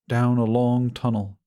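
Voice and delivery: ordinary delivery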